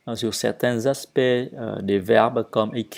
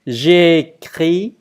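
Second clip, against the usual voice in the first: slow, loud, raised recitation; pronunciation model; 3 semitones higher, 7 dB louder, much slower than usual. Shown as a demonstration. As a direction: stretch out every syllable for learners. Teacher models next verb forms.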